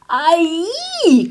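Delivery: high, loud, drawn-out yelp; crying out. A narrator's reading. Pig gets coffee spilled on him, crying out. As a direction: yelp in shock, high and long. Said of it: "cried the pig".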